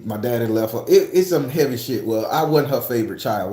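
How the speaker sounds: normal talking voice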